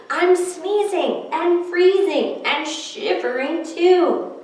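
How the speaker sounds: a hint of a small boy; little boy. Little boy fretting about the snowman, lightly voiced. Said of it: "a little boy said".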